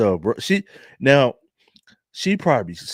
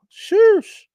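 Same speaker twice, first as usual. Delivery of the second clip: high-pitched exclaim; 8 dB louder than usual. Real, not acted.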